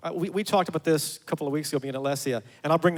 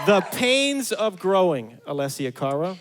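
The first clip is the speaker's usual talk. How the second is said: announcing the album; 5 dB louder, slower than usual. Real, not acted. Host announces album and artist.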